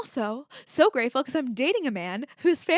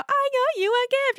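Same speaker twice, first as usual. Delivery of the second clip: mock gift presentation; 4 dB louder than usual. Mocking a formal gift presentation.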